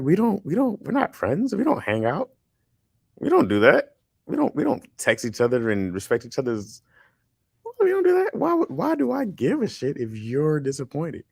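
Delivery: high-pitched, incredulous retold objection; retold protest. Host retells telling a producer they aren't friends, incredulous protest. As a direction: protest it point by point, pitched well up.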